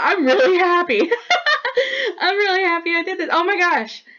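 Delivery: joy spilling over into happy tears; overjoyed, near tears. Real, not acted. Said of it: "taken by surprise at this euphoria".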